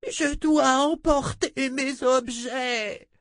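high, slow cry while struggling; struggling against restraints. Acted. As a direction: cry out, fighting free of his grip. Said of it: "cria la magicienne en se débattant".

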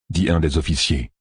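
usual speaking voice

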